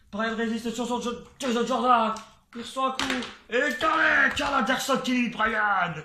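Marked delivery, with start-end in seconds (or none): 3.5-5.9 louder shouted elimination call; excited shout. Real, not acted. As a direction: yell the elimination. Calls an elimination live.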